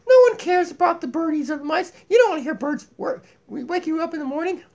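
high, lively puppet voice; Donkey puppet voice. Acted. Puppet Donkey lecturing viewers about cats, high puppet voice.

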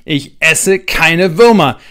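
put-on slow, loud defiant girl. A narrator's reading. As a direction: spit the refusal back at them. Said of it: "Luisa refuses again".

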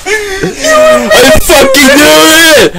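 high shrieking laugh-yell; panicked scream. Real, not acted. Jump scare in horror game.

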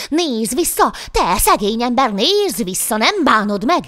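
loud, high pursuing shout; calling after someone. A narrator's reading. Devil king chasing the poor man, shouting after him. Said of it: "hogy kiabál utána az ördögök királya".

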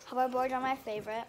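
normal talking voice

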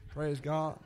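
normal talking voice

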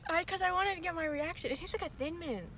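talking around a straw; just sipped a drink. Real, not acted. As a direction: wet mouth after sipping. Seen 0: Straw at lips 0.2–2.9 s, then cup lowered, speaking after sip.

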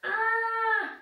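high startled squeak; high broccoli cry. Acted. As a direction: yelp it high. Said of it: "cried Broccoli".

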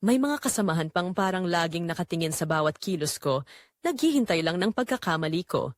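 usual speaking voice

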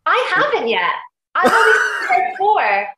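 high, loud, thrilled burst of eagerness; real excitement, voice jumping up and out; excited. Real, not acted. Actor hearing her new season is great, excited high shout.

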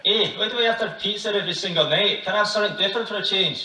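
fast, hammed-up Scottish protest; Scottish accent. Shown as a demonstration. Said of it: "can you do Scottish?".

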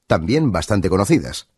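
ordinary talk, nothing marked

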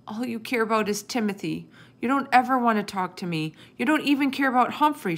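put-on high, increasingly loud child's protest; crying out. A narrator's reading. Sophie accuses her parents over Timothy, crying out. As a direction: build to an upset outburst. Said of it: "she cried".

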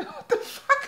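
high squeal through laughter; incredulous, very high laughing cry; shocked laughter. Real, not acted. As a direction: burst out laughing in disbelief. Cracks up at smelly feet insult.

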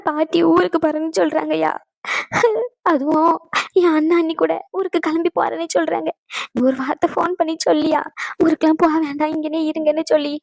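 weepy, halting appeal; tearful. Acted. Mother begging her son to stop grandmother leaving, tearful. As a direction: plead through sniffles. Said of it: "ஏம்மா அழுதுகிட்டே இருக்க".

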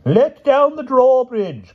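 very high, loud, drawn-out order; commanding. A narrator's reading. King orders the gate opened, commanding. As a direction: call a royal order to the gatehouse. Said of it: "commanded the king".

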